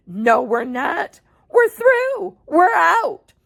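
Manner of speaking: rising to a high, loud shout; triumphant cry. Acted. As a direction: burst out in triumph. Wonka triumphant through roof.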